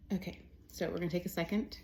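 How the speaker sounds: usual speaking voice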